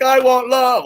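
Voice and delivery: high, loud mock plea for love; mock pleading. Mocking his own plea, loud and high. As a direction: whine it out as a parody of yourself.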